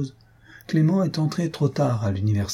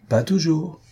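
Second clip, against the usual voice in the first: higher-pitched, meaningful objection; pointed; 6 semitones higher than usual. A narrator's reading. Waldorf slyly contradicts Louise about matchmaking women, pointedly. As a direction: say it with a hint behind it. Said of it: "ajouta Waldorf avec intention".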